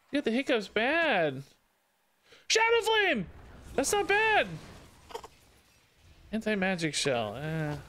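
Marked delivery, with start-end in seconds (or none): 2.5-4.5 high delighted exclamation; excited call. Real, not acted. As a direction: call out the card, thrilled. Good card appears in game.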